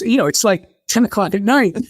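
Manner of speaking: ordinary delivery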